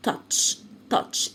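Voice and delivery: touch, the ch said as a breathy تش; whispered ch sound. Shown as a demonstration. Teacher showing the ch sound in touch, unvoiced. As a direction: breathe touch out, ending on tch. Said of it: "السي اتش بنطقها تش".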